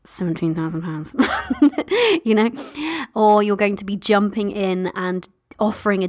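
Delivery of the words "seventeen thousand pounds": muffled and softer, trailing off with a laugh. Retold or imitated. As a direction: mumble the price like you're embarrassed. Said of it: "doing that muffled voice".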